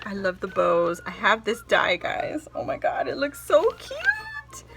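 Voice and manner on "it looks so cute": much higher, slow squeal; cute squeal. Real, not acted. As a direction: squeal at the cuteness.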